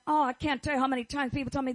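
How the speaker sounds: usual speaking voice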